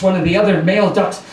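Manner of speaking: ordinary delivery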